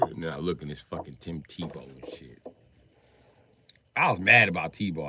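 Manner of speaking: ordinary talk, nothing marked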